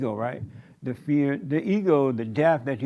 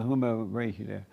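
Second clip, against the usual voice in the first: ordinary delivery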